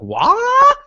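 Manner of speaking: sudden high yelp of shock; shocked what. Retold or imitated. President and Audrey hearing the news, shocked high yelp. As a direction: squeak it out in disbelief. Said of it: "the president and Audrey are like".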